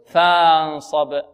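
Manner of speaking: slow فانصب with a long "faa" opening; mistaken long alif. Shown as a demonstration. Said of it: "ألف مادية". (قراءة فانصب خطأً بألف مدية)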